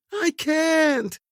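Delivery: very high, anguished cry; desperate scream. A narrator's reading. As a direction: scream it helplessly. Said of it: "Corinne screamed in desperation".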